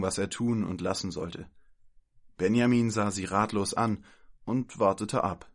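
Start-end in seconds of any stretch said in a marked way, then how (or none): none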